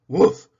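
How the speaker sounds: a loud single woof; loud bark. Acted. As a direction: bark it like a dog. Dog barks on command.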